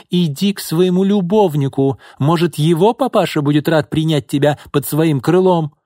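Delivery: high, loud, mocking jab; sneering aunt. A narrator's reading. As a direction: taunt her about the lover. Aunt mocks. (язвительно)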